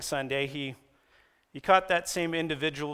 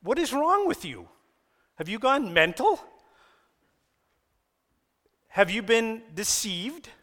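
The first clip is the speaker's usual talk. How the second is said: high, incredulous scolding; preacher paraphrasing Paul as a high, hammed-up rebuke; exasperated rant; 6 semitones higher, 3 dB louder than usual. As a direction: throw up your hands at them. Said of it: "I'd say".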